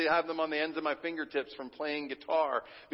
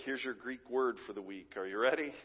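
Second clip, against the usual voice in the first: normal talking voice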